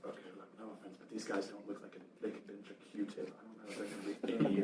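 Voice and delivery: dismissive mutter, last line fast; gruff character voice. Acted. Guthrie dismissing the scrawny prisoners, muttered.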